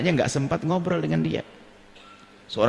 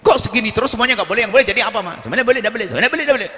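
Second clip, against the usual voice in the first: higher, louder whiny talking back; a child sassing his mother; rude child; 6 semitones higher, 4 dB louder, much faster than usual. Acted. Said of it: "kurang ajar sama ibunya". (anak membantah ibunya dengan nada tinggi)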